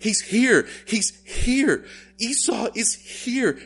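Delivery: high, loud panicked cry; a frightened man crying out that his enemy has come; terrified cry. Acted. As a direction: blurt it out in sudden terror. Jacob's terror when grabbed.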